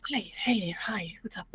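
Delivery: hushed whisper. Acted. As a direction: whisper it secretly. Characters whisper secretly.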